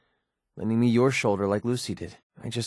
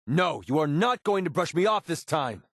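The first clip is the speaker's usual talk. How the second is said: higher-pitched, emphatic refusal; angry insistence; 5 semitones higher than usual. Acted. Refusing to be brushed off by companion, angry. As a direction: stand your ground, indignant.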